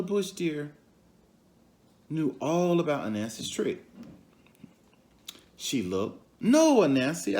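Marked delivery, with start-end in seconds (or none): 6.4-7.4 deer's reply. Acted. Deer refuses to be tricked.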